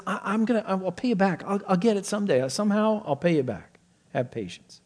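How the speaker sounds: high, stammering promises; high-pitched mock excuse-making; mock pleading debtor. Mimicking the debtor's vague promises.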